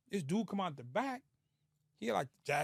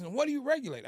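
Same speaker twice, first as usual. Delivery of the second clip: high-pitched mock yell; retold screaming; 8 semitones higher, 4 dB louder than usual. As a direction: imitate a man still yelling, pitched up. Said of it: "let's stop all the screaming".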